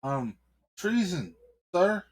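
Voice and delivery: a scared cop's voice cracking up high; cowering cop. Acted. Cop answering the angry mayor, squeaking in fear.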